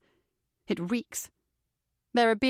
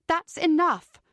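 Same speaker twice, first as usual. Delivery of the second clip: high, raised command; urgent shout; 7 semitones higher, 3 dB louder, slower than usual. A narrator's reading. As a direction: cry out to stop him. Heroine stops the beating.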